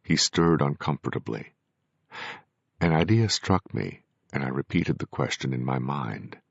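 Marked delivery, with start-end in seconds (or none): none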